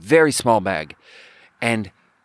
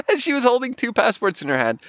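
laughing; 11 semitones higher than usual. Real, not acted.

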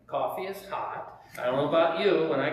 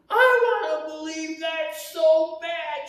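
loud, high, drawn-out mock wail; imitated bawling; 12 semitones higher, 7 dB louder, slower than usual. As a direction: wail it slowly as if bursting into tears. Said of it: "she busts out bawling".

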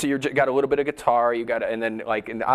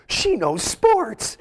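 mock amazement; 9 semitones higher, much slower than usual. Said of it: "it was like".

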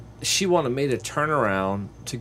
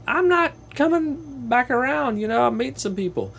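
refusing; 14 semitones higher than usual. Retold or imitated. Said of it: "And I'm just like".

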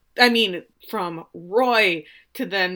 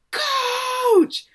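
high, drawn-out scream; Beard; 4 dB louder than usual. Retold or imitated. Assistant calling the coach mid-game, long high scream. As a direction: yell it out long and high across the pitch. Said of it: "you hear Beard scream".

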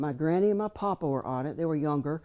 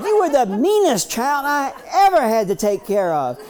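put-on stern old maid; 10 semitones higher, 8 dB louder than usual. Maid scolding the grown boy.

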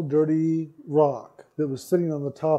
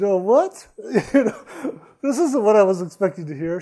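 high, laughing disbelief; retold inner shock; 4 semitones higher, 4 dB louder than usual. Speaker retells inner reaction to client's confession, flabbergasted. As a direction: play up the inward panic, half laughing. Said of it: "Inside I'm going".

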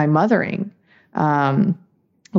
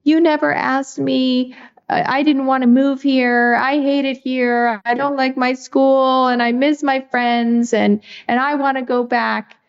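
imitating crying daughter; 8 semitones higher than usual. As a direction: mimic an upset little girl, pitched well up. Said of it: "she starts crying and she's like".

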